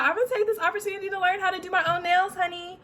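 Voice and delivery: high, rapid self-quote; sassy excited resolve. Retold or imitated. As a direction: rattle it off high, like a bold announcement. Acts out her own excited resolution.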